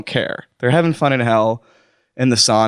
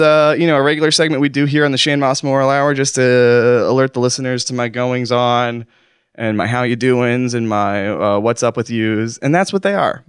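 usual speaking voice

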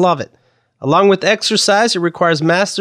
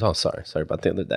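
much lower, much softer sheepish apology; meek apology; 12 semitones lower, 11 dB quieter, much faster than usual. Retold or imitated. The subdued UPS man apologizing, meek and soft. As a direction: mumble it like a chastened man. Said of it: "He goes".

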